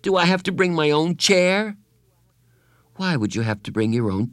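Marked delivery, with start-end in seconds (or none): 0.0-1.8 put-on higher, anxious boy's question; whining boy. A narrator's reading. Bradley anxiously asking Carla about the party. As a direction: fret like a child before a party. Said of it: "Bradley whined".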